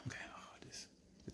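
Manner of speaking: real hushed whisper to the family, breathing out the words. Real, not acted. Vendor telling his family about the trouble, a tired whisper. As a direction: drop to a whisper, worn out by it all. Aside to family after the rant.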